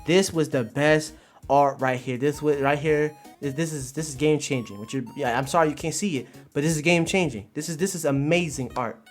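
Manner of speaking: ordinary talk, nothing marked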